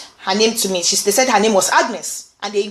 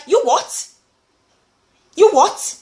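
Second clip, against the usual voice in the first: retold incredulous challenge, pitched up on the repeat; indignant disbelief; 5 semitones higher than usual. Support worker reacting to coworker's phone gossip, indignant disbelief. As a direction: act out being taken aback, with a pause before repeating. Retells her shocked reply to coworker.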